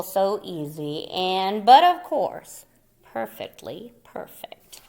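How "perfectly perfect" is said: breathy secret pride; hushed satisfied whisper. Acted. Moose whispers proudly to himself at his finished work, in a hushed voice. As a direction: whisper it, delighted with yourself.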